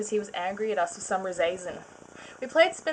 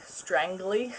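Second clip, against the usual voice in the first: ordinary delivery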